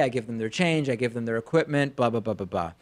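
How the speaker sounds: ordinary talk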